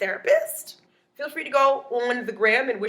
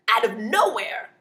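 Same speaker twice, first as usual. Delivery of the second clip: usual speaking voice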